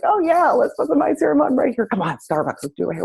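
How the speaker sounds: high-pitched mock excitement, settling by the end; hammed-up gleeful urging; playful egging-on. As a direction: coax a friend in a giddy high voice. Egging friend on, fun exchange.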